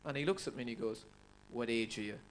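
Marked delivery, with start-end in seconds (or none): none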